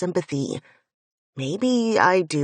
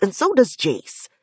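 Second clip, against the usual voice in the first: loud, hot-tempered protest; furious denial; 3 semitones higher, 6 dB louder than usual. Acted. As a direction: spit it out in anger. Finn flares with anger.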